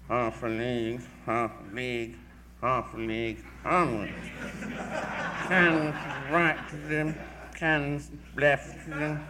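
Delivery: lightly mimicked, slow Victorian recitation with a laugh in it; imitating Tennyson reciting. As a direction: critic chuckling, mimicking Tennyson's old recorded reading, mock grand recitation. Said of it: "that famous old record made by Alfred Lord Tennyson".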